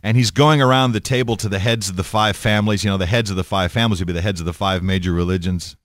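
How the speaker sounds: normal talking voice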